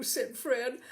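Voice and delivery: usual speaking voice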